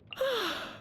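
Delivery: a high, falling sigh of surprise at the hour; tired sigh. Acted. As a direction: sigh as the late hour sinks in. Realizes it is late.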